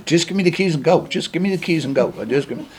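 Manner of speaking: imitating Rita begging for the keys, mildly; a hint of a coworker pleading; retold begging. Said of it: "Rita was begging me".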